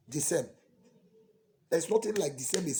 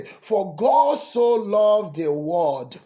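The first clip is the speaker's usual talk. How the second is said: slow preaching; 6 dB louder, much slower than usual. Retold or imitated. Mimicking preachers' verse.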